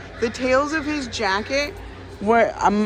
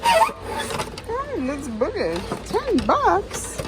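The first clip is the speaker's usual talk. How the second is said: excited surprise; 10 semitones higher, much slower than usual. Real, not acted. Thrilled at the low price.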